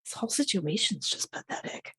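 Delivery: low, hushed resignation; quietly dismissive. Acted. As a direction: let it drop softly, giving up on him. Daughter gives up on father.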